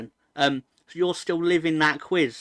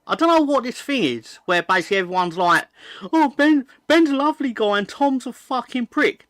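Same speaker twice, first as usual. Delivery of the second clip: mock outraged rant; 7 semitones higher, 3 dB louder than usual. Mocking what everyone says.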